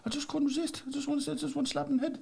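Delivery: very high mock voice through laughter; laughing traveller voice. Friend imitating the traveller's excuse, high and laughing. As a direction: squeak it out, cracking up.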